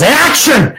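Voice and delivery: high-pitched shout of a film director; mock director calling action; director's yell. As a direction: call it out high to start the scene. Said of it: "so he yells".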